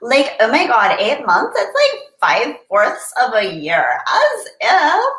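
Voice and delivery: put-on high, bubbly Valley Girl gush. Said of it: "let me get my best Valley Girl voice on".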